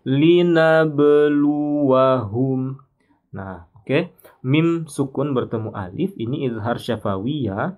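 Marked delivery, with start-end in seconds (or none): 0.1-2.8 Linabluwahum chanted slowly and louder with a bounced b; slow melodic linabluwahum with a clear qalqalah. Shown as a demonstration. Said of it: "Kita baca e ya".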